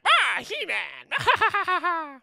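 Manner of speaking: high, drawn-out villain cry breaking into a cackle; Skeletor. Acted. Podcaster acts out the cartoon villain running around, high cackling shriek. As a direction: shriek it out long and loud, then cackle. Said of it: "there's Skeletor just, like, running around like".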